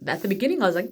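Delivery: usual speaking voice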